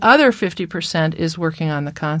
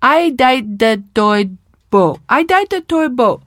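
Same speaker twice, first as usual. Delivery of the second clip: mock child, slow, higher, consonants half-formed; child's improving attempts; 6 semitones higher, 3 dB louder, slower than usual. Shown as a demonstration. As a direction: act out a child getting closer. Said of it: "With each advancing level".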